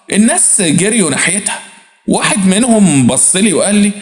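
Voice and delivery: ordinary delivery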